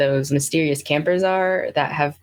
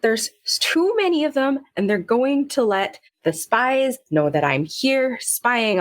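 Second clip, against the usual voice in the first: agitated Willow; 8 semitones higher than usual. Acted. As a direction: fret in a high put-on voice.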